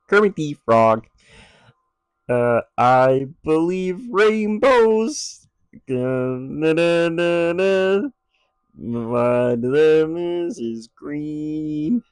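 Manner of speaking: high, loud, slow froggy puppet voice; Kermit the Frog impression. Shown as a demonstration. Showing off an impression to a co-host, high loud puppet voice. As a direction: sing it out like the felt frog. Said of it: "my Kermit the Frog isn't half bad".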